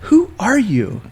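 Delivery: "Who are you?" pitched very high and slow, falling; high-voiced challenge. Woman squeezed between passengers questions him, high imitated voice. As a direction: put on her high, drawn-out questioning voice.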